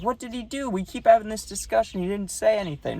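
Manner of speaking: high-pitched exasperated protest; imitated arguing coach. Coach defending his catcher to the umpire, mock exasperated. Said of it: "comes out to argue it".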